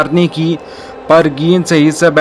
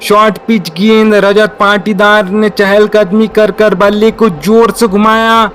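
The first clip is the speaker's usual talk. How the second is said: excited cricket commentary; 4 semitones higher than usual. Real, not acted. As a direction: build up as the ball flies. Live call of a catch.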